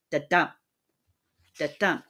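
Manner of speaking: "Da-dum" with the second syllable stressed; a short "Da" stepping into a strong "dum"; iambic beat. Shown as a demonstration. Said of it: "we have an iamb here".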